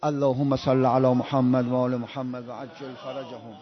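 low, soft and slow blessing, murmured devoutly; devout salawat recitation. Acted. Preacher pausing to bless the Prophet, softly and slowly. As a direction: bless the Prophet quietly and unhurried.

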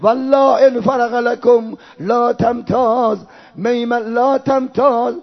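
slow, sustained chanted mourning; farewell lament. Acted. Reciter voicing father's last farewell to daughter, mourning lament. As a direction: draw out each phrase in grieving recitation.

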